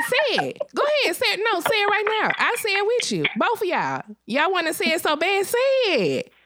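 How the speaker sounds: high, pressing dare; insistent dare. Retold or imitated. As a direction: push them again and again. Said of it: "he's just saying".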